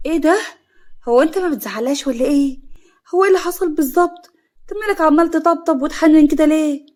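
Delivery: high, loud indignant surprise; astonished. A narrator's reading. Mother finding her son comforting his wife, astonished. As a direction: burst in incredulous, pitch up. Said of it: "جت فوزية باستغراب".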